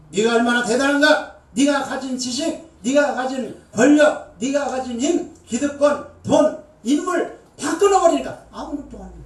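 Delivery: God's voice questioning, booming; preaching at full force. Acted. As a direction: drive each item home. God interrogating, preaching force.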